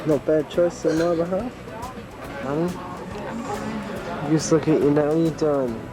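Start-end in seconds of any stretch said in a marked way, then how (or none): none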